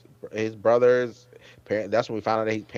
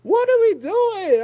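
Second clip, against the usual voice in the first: much higher, slow disbelieving squeal; exasperated squeal; 5 dB louder, slower than usual. Retold or imitated. Asking the characters what they are doing, high squeal. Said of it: "I was like".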